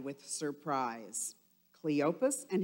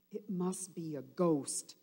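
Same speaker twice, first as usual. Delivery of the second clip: usual speaking voice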